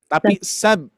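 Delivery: sab with the b barely released; light sab. Shown as a demonstration. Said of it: "hati-hati, jangan sab".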